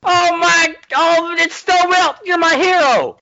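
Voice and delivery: starstruck fan. Pretend. Thrilled fan meets hero.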